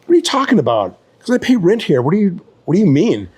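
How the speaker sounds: high-pitched, incredulous objection; indignant protest. Retold or imitated. Roommate told to move out, indignant.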